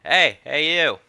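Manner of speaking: a lightly voiced rough country accent, loud and kindly; rough country brogue calling out to a waking lad; gently put-on rural accent; rustic accent. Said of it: "It's a better accent than I thought it'd be".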